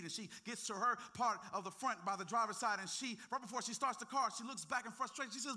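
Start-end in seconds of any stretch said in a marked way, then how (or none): none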